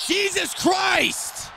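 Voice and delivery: high, drawn-out shout; high-pitched cry of dismay; exasperated scream. Real, not acted. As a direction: wail it in shock, stretched long. Shock at a game moment.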